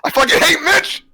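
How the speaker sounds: mock angry outburst.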